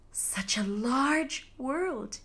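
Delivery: slow, whispered wonder at "Such a large world"; amazed thought. A narrator's reading. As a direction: breathe it out slowly, stunned. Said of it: "he thought in amazement".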